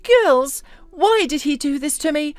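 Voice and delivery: high-pitched, acted wail to friends; mildly hammed-up tearful self-pity; sobbing. A narrator's reading. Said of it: "openly sobbing".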